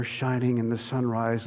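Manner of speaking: usual speaking voice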